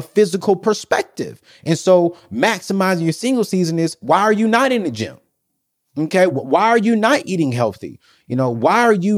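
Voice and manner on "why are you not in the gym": ordinary talk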